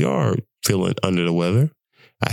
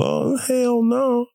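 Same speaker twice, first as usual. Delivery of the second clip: much higher, slow drawn-out oh hell no; outraged refusal; 13 semitones higher, much slower than usual. Real, not acted. Vlogger refusing the flu, slow high outraged hell no. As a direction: stretch it out in disbelief, pitch way up.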